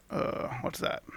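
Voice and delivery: soft unvoiced whisper; hushed shock. Real, not acted. Player whispering in shock at the creature. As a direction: gasp it under the breath.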